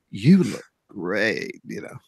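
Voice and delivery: low, very slow imitation prompt; affirming headphone voice. Host imitates his headphones affirming him, slow low voice. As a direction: imitate the device's compliment, drawn out and low. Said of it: "she affirmed me in multiple ways".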